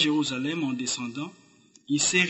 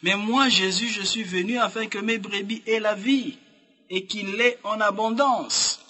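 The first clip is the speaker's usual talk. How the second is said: higher, ringing preacher's declaration; fervent proclamation; 7 semitones higher, 3 dB louder than usual. Acted. Preacher voices Jesus promising abundant life, fervent proclamation. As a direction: proclaim it, drawing out the ending.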